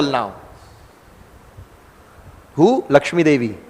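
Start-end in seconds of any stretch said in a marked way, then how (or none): none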